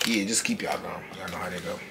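whisper. Real, not acted. Whispered aside.

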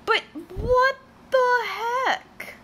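high, slow whine; whiny what the heck. Real, not acted. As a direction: stretch it out like a mock wail. Confused by game, whiny outburst.

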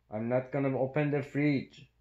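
normal talking voice

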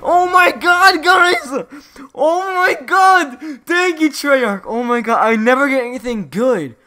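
real high, very loud celebration; ecstatic screaming. Real, not acted. Gamer thanking viewers after winning rare camo, ecstatic screaming. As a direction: scream with joy, drawing out the big exclamations. Just won the camo he wanted.

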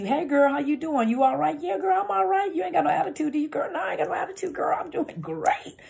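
put-on very high-pitched gushing girlfriend chatter; exaggerated upbeat high voice; bubbly, no attitude. Shown as a demonstration. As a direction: squeal through a cheery catch-up, sing-song. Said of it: "as opposed to".

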